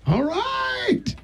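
high crying yelp of joy, played for laughs; mock sobbing cheer. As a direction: squeal it like fake tears of joy.

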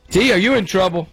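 ordinary delivery

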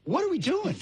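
high-pitched, fed-up stepfather; exasperated stepdad. Acted. Stepdad confronting the household, exasperated. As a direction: demand it high, in mock exasperation.

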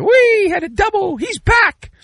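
high-pitched sarcastic cheer; mock-thrilled whooping; mock celebration. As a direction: squeal like an overjoyed fan, hammed up. Mock cheering a player's hit.

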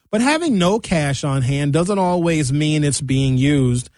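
usual speaking voice

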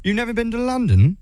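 high-pitched fake British question; bad British accent. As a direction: ask in a hammed-up British accent. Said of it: "do my absolute worst British accent".

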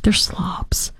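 slobs breathed out as a disgusted whisper; whispered insult. Real, not acted. Host whispering contempt at protesters, hushed disgust. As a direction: hiss it under your breath in contempt.

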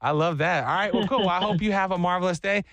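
usual speaking voice

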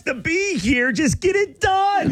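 very high, mock-kid exasperation; hammed-up high-pitched pupil complaint; whining pupil. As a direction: wail it like an impatient schoolkid, dragging the end. Impatient pupil act continues.

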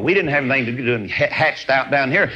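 normal talking voice

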